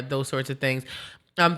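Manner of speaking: ordinary delivery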